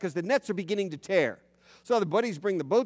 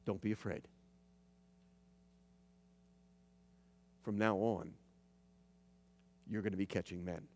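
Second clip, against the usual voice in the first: soft, much quieter reassurance; words of comfort; 9 semitones lower, 10 dB quieter than usual. Retold or imitated. Jesus comforting the kneeling Peter, soft and gentle. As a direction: speak gently, as if comforting a frightened man. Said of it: "words of comfort come out".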